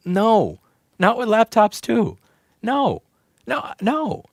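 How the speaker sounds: high-pitched, pleading refusals; anguished protest. Real, not acted. Streamer refuses to lose headphone jack on laptops too, anguished.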